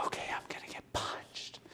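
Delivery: soft whispered aside; whispered dread. Retold or imitated. Leaning over the patient expecting a punch, whispered. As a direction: breathe it to yourself, bracing. Bracing before the patient grabs him.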